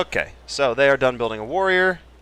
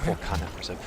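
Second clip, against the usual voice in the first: softer, lower mock repeat; self-mocking echo; 3 semitones lower, 7 dB quieter, faster than usual. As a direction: quietly mimic your own slip of the tongue. Mocks own slip of tongue.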